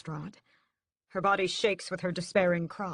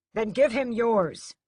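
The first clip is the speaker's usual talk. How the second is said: slow, louder urging; urgent demand; 6 semitones higher, 8 dB louder, slower than usual. A narrator's reading. Brother demanding mother give her blood. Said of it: "Mother gasps".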